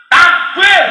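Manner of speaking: high, loud fighters' rallying shout; rousing battle cry. Acted. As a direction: shout it to fire up the troops. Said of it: "Untuk membakar semangat para pejuang".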